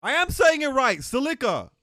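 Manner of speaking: loud, high protest; exasperated shout. Real, not acted. Insisting to chat she pronounces it right, shouting. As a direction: yell it in pure frustration.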